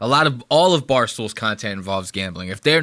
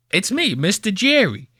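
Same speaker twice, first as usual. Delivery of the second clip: higher-pitched mock kids-show host; Mister Jerry; 7 semitones higher than usual. Host spoofing Jerry greeting kids, high hammed-up Jersey kids-host voice. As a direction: parody a Jersey children's presenter. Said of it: "He wants to be Mister Jerry".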